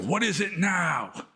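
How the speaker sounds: high, slow, drawn-out exasperated woman; exasperated female voice. Retold or imitated. Monk imitating a village mother answering her screaming baby, exasperated female voice. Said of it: "this exasperated female voice".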